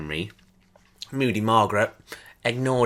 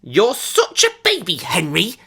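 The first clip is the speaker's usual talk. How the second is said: scornful taunt; 7 semitones higher than usual. A narrator's reading. Claire mocks screaming Henry.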